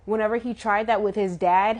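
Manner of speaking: normal talking voice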